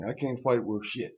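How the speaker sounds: ordinary talk, nothing marked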